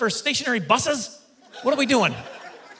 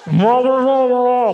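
loud wordless mwah-wah garble; booming cartoon trombone talk; Charlie Brown's teacher; 3 semitones higher, 7 dB louder than usual. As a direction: imitate an instructor nobody can understand. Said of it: "instructor is, uh, Charlie Brown's teacher".

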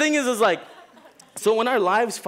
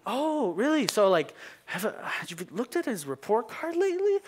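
sweet teacher voice; 7 semitones higher than usual. Retold or imitated. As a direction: ask with a smile that means mischief. Teacher springs the question.

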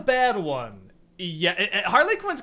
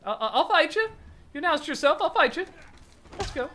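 mock challenge; 9 semitones higher, faster than usual. Mock challenge to villain appearing.

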